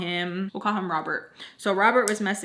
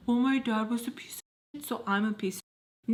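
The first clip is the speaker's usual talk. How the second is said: mocking excuse-maker; 3 semitones higher than usual. Mocking men who blame their fathers, lightly whiny. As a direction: sneer at the dad excuse. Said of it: "I hate that argument when people are like".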